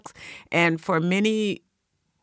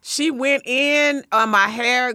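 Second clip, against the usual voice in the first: normal talking voice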